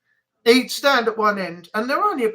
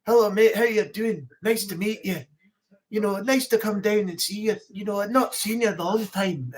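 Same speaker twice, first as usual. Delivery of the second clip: gentle Scottish lilt; lighter Scottish accent. Shown as a demonstration. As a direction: do a mild, easygoing Scottish accent. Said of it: "the lighter Scottish as well. They're not as strong".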